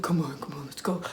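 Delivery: low, very soft quoted voice; hushed urging. Retold or imitated. Guy pulling his friend away, hushed urging. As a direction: imitate quietly coaxing someone away.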